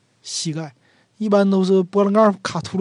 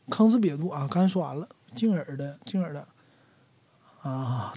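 ordinary talk, nothing marked

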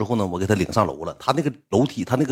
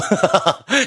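real laughter rising in pitch; an amused rising giggle; 8 semitones higher, 3 dB louder than usual. Real, not acted. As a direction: laugh at your own slip. Laughs after slip.

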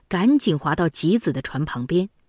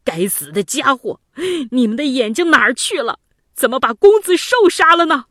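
越骂越响，怒斥士兵; angry scolding; 7 semitones higher, 5 dB louder than usual. A narrator's reading. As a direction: scold the soldiers, building to a yell. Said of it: "骂士兵们说". (愤怒地大声骂)

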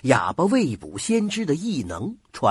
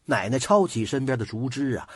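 ordinary delivery, nothing marked

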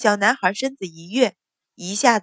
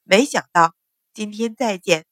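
plain reading voice

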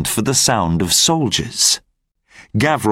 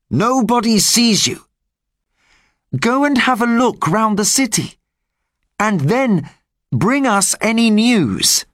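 high, bright-voiced young leader giving orders; rebel leader Enjolras; 12 semitones higher than usual. Enjolras sending Gavroche to scout, high brisk command.

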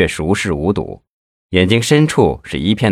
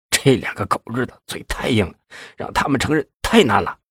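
softer, grumbling swear under the breath; muttered curse; 2 semitones higher, 6 dB quieter than usual. A narrator's reading. Interrogator quietly curses the stubborn suspects to his boss. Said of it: "庄小宝低声地骂道".

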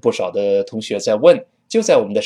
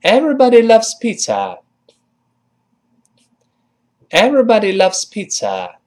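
higher, louder "Everybody loves pizza" falling at the end; model reading; 8 semitones higher, 4 dB louder than usual. Shown as a demonstration. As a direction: lead the class clearly through the sentence. Teacher's model sentence for repeating. (提高音调、加大音量领读)

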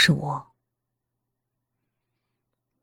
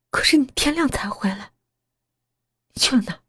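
high-pitched, held-back accusation; anguished questioning; 7 semitones higher, 3 dB quieter, faster than usual. Acted. Daughter asks mother where she went, high and anguished. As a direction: press for the truth without raising volume.

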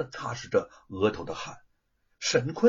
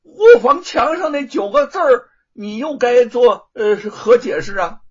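much louder, high, gloating; smug challenge; 7 semitones higher, 11 dB louder than usual. A narrator's reading. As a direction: taunt him with the proof. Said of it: "罗庞得意地转向吴承恩".

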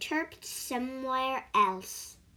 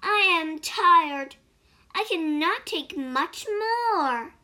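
louder complaint, raised pitch; tired, fed-up mouse; 5 semitones higher, 7 dB louder than usual. Acted. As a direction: push back, worn out and cross. Mouse pleading, measures loud.

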